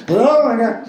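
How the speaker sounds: high, loud shocked exclamation; a star just phoned you, high and loud; stunned gasp. Retold or imitated. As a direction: squeal it like you can't believe who's calling. Shocked reaction to famous caller.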